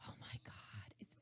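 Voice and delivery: hushed, breathy whisper; under-the-breath whispered amazement. Real, not acted. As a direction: whisper it in disbelief at how long it's been. Marvels at how long ago it was.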